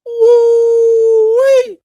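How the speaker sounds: high, loud delighted holler; woo-wee holler. Real, not acted. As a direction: whoop like you can't believe it. Marvelling at the dressed-up man.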